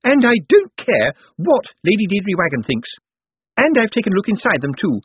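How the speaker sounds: high-pitched, emphatic accusing outburst; defiant indignation. A narrator's reading. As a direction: throw caution away and denounce them. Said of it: "decided she'd rather be scarlet than grey".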